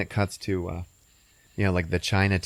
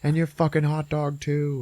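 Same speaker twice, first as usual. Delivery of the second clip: villain threat; 7 semitones higher than usual. Retold or imitated.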